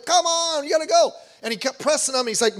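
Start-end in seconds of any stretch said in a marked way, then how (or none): 0.0-1.1 high insistent begging; pleading son. Acted. Absalom pressing King David to come to the party, high and begging. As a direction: coax your dad like a whiny teen.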